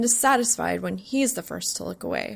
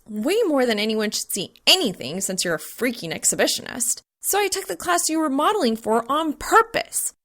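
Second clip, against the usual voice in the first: flustered rant; 5 semitones higher than usual. Acted. Flustered angry explanation.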